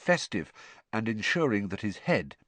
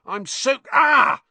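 high, loud cry cutting off the sentence; startled yell; 9 dB louder, slower than usual. A narrator's reading. Ron to friends as a water balloon hits him, startled yell. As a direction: break off into a sudden high yelp.

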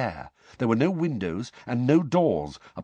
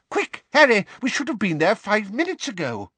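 high, loud hurried call; urgent; 12 semitones higher, 4 dB louder than usual. A narrator's reading. Mister Weasley urges Harry to run to the hearing. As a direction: hurry him out the door.

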